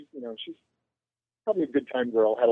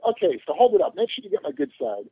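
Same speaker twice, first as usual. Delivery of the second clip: higher, lightly voiced vain star; vain celebrity; 10 semitones higher than usual. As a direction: play the preening star who wants his good side. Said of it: "he's being a little, like".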